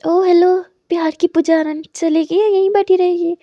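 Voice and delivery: hey, lovestruck, move it; a friend loudly mocking her daydreaming; teasing callout. Kajal teasing, calling her out.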